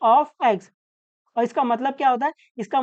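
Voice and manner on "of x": "P of X" drawn out very slowly; slow read-aloud. Shown as a demonstration. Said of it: "ये bracket को ऑफ पढ़ते हैं".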